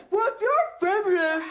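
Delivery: high, scornful what, you're a feminist; high mocking incredulous dude; dude impression. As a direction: sneer it like a smug guy. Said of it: "That's my impression of that dude".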